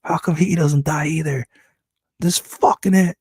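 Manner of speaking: losing it, demand answers; snapping. Retold or imitated. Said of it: "He's fucking snapping".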